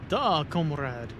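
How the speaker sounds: a slow, heavy fake Russian "Duh, comrade", with Duh drawn out as Da; a hammed-up Russian accent, leaning on Da; agreeing in a bad Russian accent, slow and deliberate; mock Russian accent. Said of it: "Typical Russian sentence".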